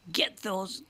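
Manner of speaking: hint of a dying grandfather's rasp; lightly voiced old man's last wish. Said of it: "he whispered into my ear".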